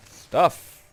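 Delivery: loud, excited shout of glee. Real, not acted. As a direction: burst out with excitement. Excited at finding loot.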